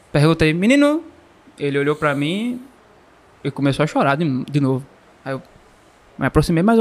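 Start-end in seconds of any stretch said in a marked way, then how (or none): 0.5-1.0 calling out. Retold or imitated. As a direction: call out to the boy in the dark. Calling out to crying boy.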